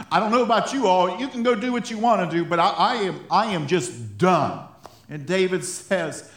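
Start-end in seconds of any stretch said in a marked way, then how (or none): 0.1-4.7 louder, fast, laughing mock exasperation; hammed-up done-with-it rant; exasperated quitter. Preacher to congregation, a laughing fed-up quitter voice.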